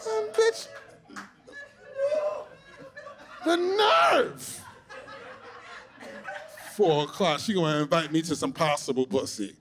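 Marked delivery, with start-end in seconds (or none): 0.1-4.6 high, scandalized cry; indignant. Acted. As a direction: scoff in mock outrage.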